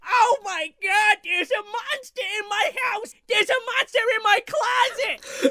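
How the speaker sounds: high, hammed-up cry of terror; mock panicked scream. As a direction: yell it like a man screaming out the window about a monster. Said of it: "this guy's like".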